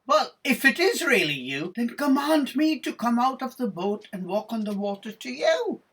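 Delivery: high, loud, eager storybook Peter; impulsive challenge. Acted. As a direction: call out boldly, rising at the end. Peter blurts challenge to Jesus.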